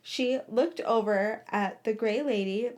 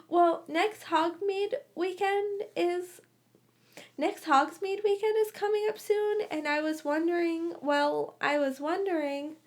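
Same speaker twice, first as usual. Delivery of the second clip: nervous; 7 semitones higher than usual. A narrator's reading. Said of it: "scuffed his shoe nervously".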